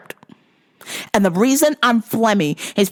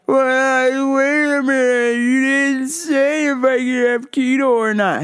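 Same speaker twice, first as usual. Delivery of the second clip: whiny customer; 5 semitones higher, slower than usual. Retold or imitated. Imitating customer's demand.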